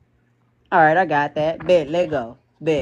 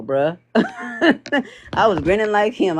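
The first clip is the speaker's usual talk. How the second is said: ordinary talk, nothing marked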